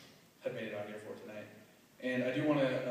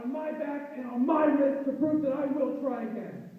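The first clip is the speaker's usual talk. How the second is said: slam poem recitation; 13 semitones higher, 7 dB louder than usual. Acted.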